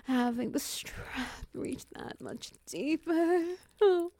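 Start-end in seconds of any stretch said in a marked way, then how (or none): 3.8-4.1 high loud moan of pleasure. Acted. Pleasure during roleplay.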